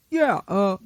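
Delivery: usual speaking voice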